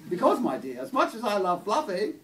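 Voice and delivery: loud, high, posh English lady; Maggie Thatcher accent. Acted. Posh lady explaining her poodle's fate to a visitor, posh accent. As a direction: justify it grandly in an upper-class accent. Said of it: "posh sort of Maggie Thatcher accent".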